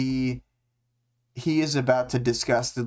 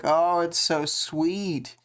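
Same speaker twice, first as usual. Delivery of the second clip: high, slow mock gush over the couple; 6 semitones higher, slower than usual. Host mocking the couple's sweetness with a slow high gush. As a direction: coo it out like it's adorable. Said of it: "it's like".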